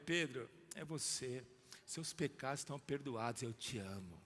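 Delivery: Jesus reassuring Pedro, softly; tender forgiveness. Acted. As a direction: speak forgiveness gently.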